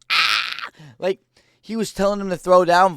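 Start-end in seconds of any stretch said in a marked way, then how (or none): 0.1-0.7 sharp, high yelling taunt; mock hyped yell. Fighter screaming at his opponent to throw down, mock hyped yell. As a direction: yell it like a fighter daring him to brawl. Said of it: "He was screaming at Volk".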